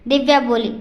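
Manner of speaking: plain reading voice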